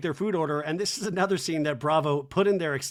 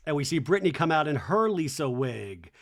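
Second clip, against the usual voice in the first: ordinary talk, nothing marked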